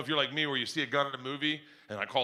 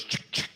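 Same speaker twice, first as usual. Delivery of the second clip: imitated pump-action click; mouthed gun racking sound; shotgun pump noise. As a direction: make a sharp two-beat cocking noise. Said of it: "the one that goes".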